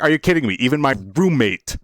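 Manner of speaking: indignant at the roommate; mock outrage. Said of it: "And I was like".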